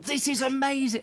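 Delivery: high, thrilled boss voice; the boss can't believe the prank; delighted. Retold or imitated. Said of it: "he was going".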